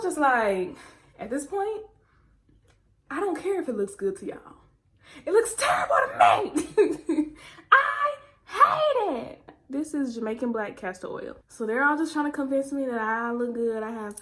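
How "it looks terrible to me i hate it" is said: high, loud laughing wail; laugh-cry through the complaint. Real, not acted. Laughing protest.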